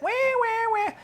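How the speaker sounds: high, thin, soft siren-like "wah"; mocking whine. Storyteller mimics the complaining women for children, mocking whine. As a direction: imitate whining in a high, drawn-out voice. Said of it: "used to that sound".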